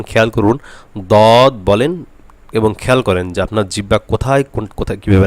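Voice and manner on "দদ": very slow, drawn-out heavy দদ; দদ stretched out for learners to study; demonstrated Dad. Shown as a demonstration. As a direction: hold the thick Arabic d and long vowel in দদ. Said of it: "আমরা দদ বলার সময় দেখুন".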